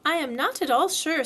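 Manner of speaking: thoughtful. A narrator's reading. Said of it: "said Kanga in a thoughtful voice".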